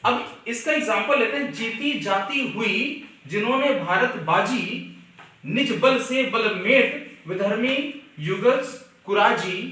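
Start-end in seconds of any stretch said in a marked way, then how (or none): 1.5-9.6 slow, measured verse recitation; poem recital. Shown as a demonstration. Teacher reciting the Shivaji verse to students, slow measured recital. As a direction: recite each phrase slowly and deliberately.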